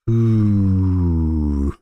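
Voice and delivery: long, low, sliding ooh; wary ooh. Real, not acted. Host looks at AVGO chart, long low wary ooh. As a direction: draw out a low, sinking "ooh".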